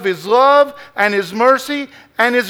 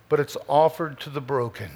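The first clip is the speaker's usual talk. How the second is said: much softer, low, hushed "broken"; soft repeat; 7 semitones lower, 10 dB quieter, faster than usual. Shown as a demonstration. Pastor repeating the point to the church, suddenly hushed. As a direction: drop it to a hush the second time. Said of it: "I'm gonna say that again".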